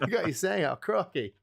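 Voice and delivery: mock Australian accent. Australian retries the joke, light Aussie impression. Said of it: "my Australian imitation so bad".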